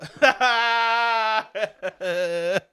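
long high burst of laughter; real drawn-out laugh. Real, not acted. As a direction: cackle out loud, trailing off. Cackles at the quoted retort.

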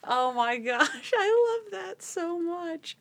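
high, slow, laughing squeal of delight; drawn-out words breaking up with laughter; real helpless laughter. Real, not acted. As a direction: speak through the tail of a giggle fit. Helpless laughter after own retelling.

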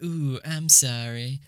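slow, drawn-out meme mimicry; mock apology. Introducing the South Park clip, drawn-out mock apology. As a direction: stretch out a teasing fake sorry. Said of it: "This is the, uh, like".

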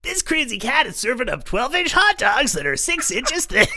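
high-pitched hammed-up host voice with a laugh in it; laughing mock food-show announcer; mock TV host hype. Impersonating a TV host.

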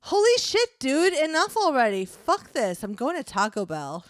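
high, loud, hammed-up exasperation; exasperated outburst. Roommate giving up and leaving for Taco Bell, hammed-up exasperation. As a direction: burst out, then settle as you storm off. Acted fed-up roommate storms off.

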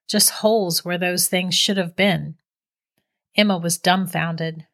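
ordinary delivery, nothing marked

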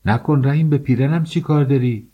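wife shouting at Rahim to leave her dress; cry out. Acted. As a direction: cry out to stop him. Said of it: "فریاد زدم".

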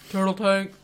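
robotic password voice; toy robot voice. Retold or imitated. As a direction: say it like a talking toy. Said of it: "beep, boop, beep".

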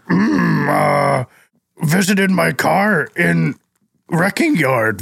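slow, gruff monster voice; lightly voiced Cookie Monster. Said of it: "in your best Cookie Monster voice".

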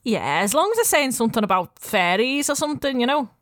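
a light Scouse market-stall mum; lightly voiced Liverpool accent, friendly. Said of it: "while I'm doing a Liverpool accent".

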